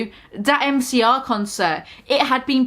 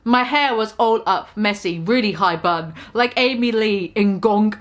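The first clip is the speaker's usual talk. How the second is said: usual speaking voice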